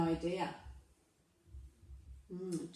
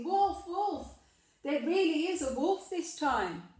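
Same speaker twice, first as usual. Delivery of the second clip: shouting a false alarm; 6 semitones higher than usual. A narrator's reading. Shepherd boy runs into town, shouting a fake warning. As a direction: cry wolf with mock urgency.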